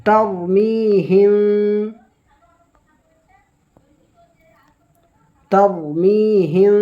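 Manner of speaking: "তারমিহিম" with a full heavy ra. Shown as a demonstration. Teacher demonstrating the heavy ra. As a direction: make the ra in "তারমিহিম" thick. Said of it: "এই রটা পুর পড়বো".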